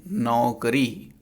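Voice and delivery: नौकरी said very slowly with a long open au; teacher's slow model of नौकरी; drawn-out correct form. Shown as a demonstration. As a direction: stretch out the au in नौकरी. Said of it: "औ की मात्रा होता है".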